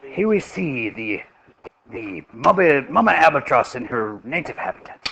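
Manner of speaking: lightly put-on Australian accent, like a nature documentary. Teacher jokingly narrating the albatross in a mild Australian accent. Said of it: "you mean like the Australian accent?".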